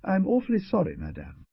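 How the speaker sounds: lightly voiced girl, suddenly contrite; a hint of a sheepish apology; apologetic girl. A narrator's reading. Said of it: "instantly apologetic".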